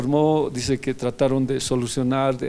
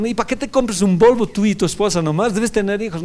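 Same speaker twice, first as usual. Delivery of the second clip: insisting; 8 semitones higher, 6 dB louder than usual. Retold or imitated. Pastor retells his insistent questions.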